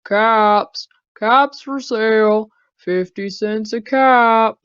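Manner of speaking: loud street-vendor call; calling out wares. Acted. Peddler calls his caps for sale in town, loud.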